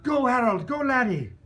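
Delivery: loud, high cheering yell; a spectator yelling at Harold to run. Retold or imitated. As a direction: cheer the runner on at full voice. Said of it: "Someone yelled".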